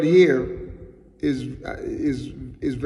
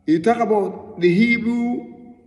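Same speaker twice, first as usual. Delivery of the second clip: rising preaching fervour; 5 semitones higher than usual. Real, not acted. Preaching rises in pitch.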